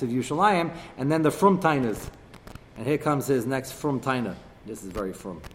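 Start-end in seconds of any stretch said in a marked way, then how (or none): 4.6-5.4 dry, low sarcastic aside. Real, not acted. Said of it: "I say that sarcastically".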